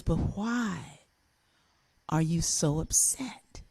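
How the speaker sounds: whispered and slow, soft concern; hushed question. Retold or imitated. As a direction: lean in and whisper it kindly. Teacher gently asks crying child.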